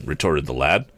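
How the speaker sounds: usual speaking voice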